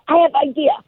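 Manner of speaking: high, eager imitation in a Japanese accent, slowing at the end; mock Japanese accent. Woman proposing to back up the sub, mock Japanese accent. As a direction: pitch up her bright idea in a Japanese accent. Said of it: "She goes".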